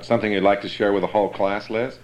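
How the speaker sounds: ordinary talk, nothing marked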